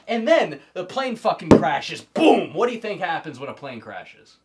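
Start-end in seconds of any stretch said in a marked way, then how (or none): 2.0-2.5 loud high explosion noise; loud boom. Retold or imitated. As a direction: shout the boom like a blast. Plane crash sound effect.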